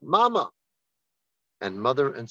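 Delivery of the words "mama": high, mildly raised mock cry; retold scream. Son sees his mother, retold high cry. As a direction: call out as if suddenly recognising her, pitched high. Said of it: "and screams out".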